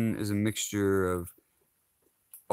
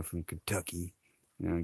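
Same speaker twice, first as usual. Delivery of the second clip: ordinary delivery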